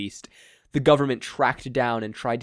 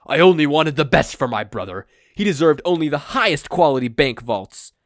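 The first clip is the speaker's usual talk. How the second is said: louder, indignant villain; 3 semitones higher, 9 dB louder than usual. Acted. All for One defending his past, loud and indignant. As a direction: declare it loudly, full of himself.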